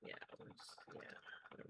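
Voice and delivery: whispering. Real, not acted. Tense moment spinning yarn.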